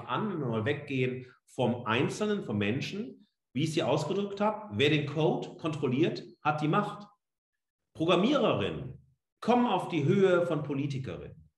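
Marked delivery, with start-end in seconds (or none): none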